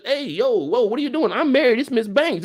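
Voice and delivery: high, urgent mock protest; indignant rebuff. Married woman stops the flirting man, indignant. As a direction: cut him off in mock indignation. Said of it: "she's supposed to".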